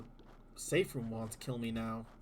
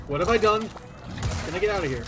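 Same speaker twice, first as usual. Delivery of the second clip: panicked; 6 semitones higher, 9 dB louder, faster than usual. Real, not acted. Trapped by enemies.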